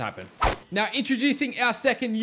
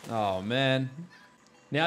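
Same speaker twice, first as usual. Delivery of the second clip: normal talking voice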